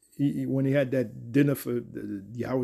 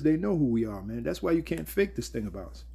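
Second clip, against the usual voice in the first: normal talking voice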